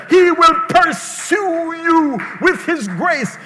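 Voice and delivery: high, emphatic preacher's cry, stretching the key words; rousing preaching. Acted. Pastor rejoices in the pursuing shepherd, rousing high preaching. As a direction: build to a drawn-out, ringing close.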